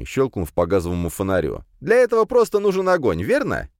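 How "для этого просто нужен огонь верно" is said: eager. Acted. Jimmy eager.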